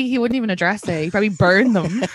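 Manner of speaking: ordinary talk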